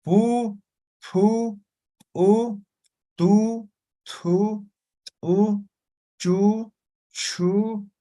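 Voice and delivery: pu, tu, chu, cu, co called out high and crisp, each repeated; syllable drill. Shown as a demonstration. Teacher drills students on Aymara syllables, high and clear. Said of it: "O con la U".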